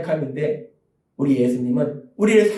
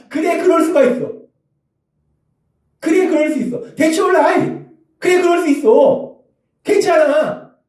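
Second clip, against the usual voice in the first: higher, bright-ish ending in a call; accepting reassurance; 9 semitones higher than usual. Shown as a demonstration. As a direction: reassure kindly instead of scolding. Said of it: "도대체 어떻게가 아니라". (가족에게 다정하게 괜찮다고 말하는 목소리)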